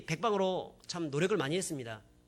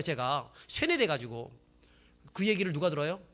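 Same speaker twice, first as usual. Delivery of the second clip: ordinary talk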